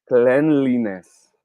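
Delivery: cleanliness very slowly, klen-li-ness; correct pronunciation. Shown as a demonstration. Teacher revealing the answer, cleanliness slowly as klen-li-ness. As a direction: draw out "cleanliness" with a short e, klen. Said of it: "This word should be pronounced as".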